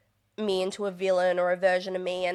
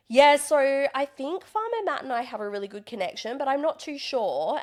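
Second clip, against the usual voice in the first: mock contestant interview; 6 semitones higher, 3 dB louder than usual. Imitating contestant's on-camera interview.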